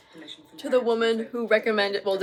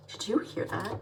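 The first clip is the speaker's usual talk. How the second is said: usual speaking voice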